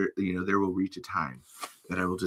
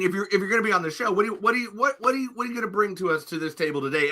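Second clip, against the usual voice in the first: excited host; 10 semitones higher, faster than usual. Real, not acted. Excited hosting interrogation.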